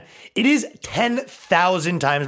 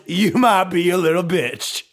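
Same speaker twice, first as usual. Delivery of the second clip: lightly put-on comic catchphrase voice; catchphrase impression; 3 semitones higher than usual. Comic quoting own catchphrase at men who cry, lightly put-on voice. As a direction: deliver it like a stand-up's signature tag. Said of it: "To quote myself".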